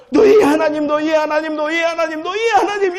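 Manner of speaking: high, rising repeated plea; Joshua's farewell cry. Retold or imitated. Joshua urging Israel before his death, high pleading cry. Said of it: "이러는 거예요".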